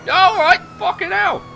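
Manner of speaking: sudden high startled yelp; jolted by a crash, yelping high. Real, not acted. Crashing mid-chase, a high startled yelp.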